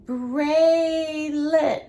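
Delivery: "Braylon" loud, in two long level beats; split into syllables. Shown as a demonstration. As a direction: stretch both syllables of "Braylon" evenly. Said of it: "Let's count the syllables".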